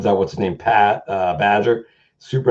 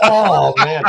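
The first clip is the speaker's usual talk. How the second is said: awed oh man; 5 semitones higher, 4 dB louder than usual. Retold or imitated. Retelling his own awe.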